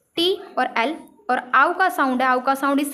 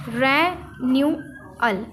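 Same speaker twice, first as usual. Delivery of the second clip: Renewal drawn out slowly, re-nyu-al; careful slow demo of renewal; slow model word; much slower than usual. Shown as a demonstration.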